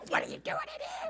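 high, airy imitation of a startled friend; mock shocked woman. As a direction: squeak it out like a shocked housemate. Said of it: "She goes".

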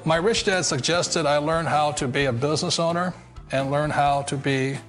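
normal talking voice

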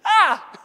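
high, laughing mock scream; straining scream. Lifter straining under a heavy bench press, screaming. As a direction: let out a strained yell under the weight. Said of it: "could hear me go".

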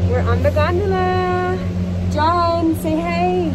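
loud and high, very excited; thrilled first gondola ride. Real, not acted. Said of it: "so I'm super excited".

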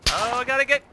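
high panicked wail; frantic, about to die in the gas. Real, not acted.

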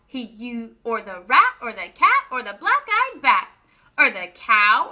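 sing-song rhyming read-aloud; reading to children. A narrator's reading. Reading a rhyming farm book to children, sing-song. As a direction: lilt each rhyme for little listeners. Rereads the rhyme.

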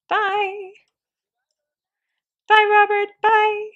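high, loud, drawn-out calls of bye; overexcited goodbye. Pretend. Player waves off the departing game son, overexcited. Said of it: "I got a little overexcited".

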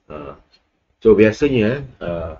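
normal talking voice

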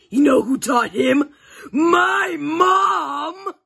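high, loud tearful shout; shouting boast. Acted. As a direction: yell it proudly.

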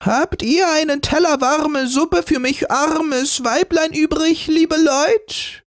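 put-on an old beggar woman's creaking greeting; creaky old woman. A narrator's reading. Old beggar woman greeting the family, creaky. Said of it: "mit knarrender Stimme".

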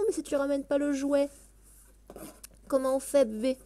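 ordinary talk

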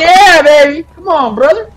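loud, fired-up holler; hyped up. Pretend. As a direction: whoop it up for a new ally. Hyping up a newfound gang mate.

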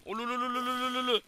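high, loud tongue-trilled ululation; high mock trill. Real, not acted. Trilling at the monster to taunt it. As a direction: taunt the mob with a warbling lolololo.

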